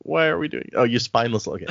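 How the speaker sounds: ordinary delivery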